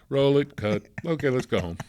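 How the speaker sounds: loud, quick set commands; retold cheerful shout to the crew; calling out on set. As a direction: call it like you're wrapping the day.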